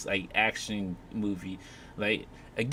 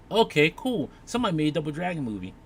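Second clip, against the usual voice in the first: mock excited kid; 6 semitones higher, 5 dB louder, faster than usual. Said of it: "you probably would have been like".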